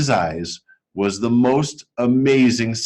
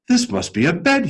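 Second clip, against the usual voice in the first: 1 teddy bear; 7 semitones higher than usual. A narrator's reading. Bear voiced in story.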